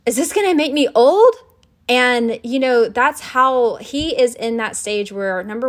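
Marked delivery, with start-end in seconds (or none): 0.1-1.3 put-on high, loud, alarmed child's voice rising at the end; imitating a frightened child's question; worried little kid. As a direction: play the scared son asking in a panic. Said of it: "he gasped and said".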